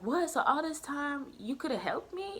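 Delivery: high-pitched incredulous question; mock-astonished, voice lifted; retold disbelief. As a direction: act out being taken aback. Retells disbelief at tutor's news.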